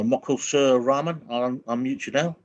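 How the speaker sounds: normal talking voice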